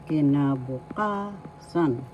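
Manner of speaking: "kinabukasan" slowly, with a long, held KA; stress on ka. Shown as a demonstration. Teacher showing learners the accent, slow "kinabukasan" stressed on KA. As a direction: draw out the fourth syllable of "kinabukasan", then drop on "san". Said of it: "The fourth syllable ka has the accent".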